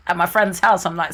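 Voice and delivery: ordinary talk, nothing marked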